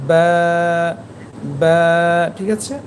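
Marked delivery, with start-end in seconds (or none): 0.1-1.8 বা held long, both lips pressed together to start it; drawn-out বা from the moist inner lips; letter articulation demo. Shown as a demonstration. Teacher showing how the letter ba is pronounced, long, lip-made বা. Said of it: "আমার মুখের দিকে লক্ষ্য করুন".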